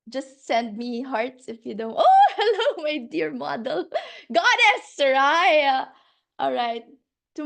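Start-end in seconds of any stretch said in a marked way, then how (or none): 1.9-5.9 high, loud, laughing hello; delighted greeting. Real, not acted. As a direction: gush a greeting to a dear friend. Greeting arriving guests with delight.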